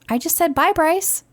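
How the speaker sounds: high lilting quote of the goodbye; sing-song repeat. Retold or imitated. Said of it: "she goes".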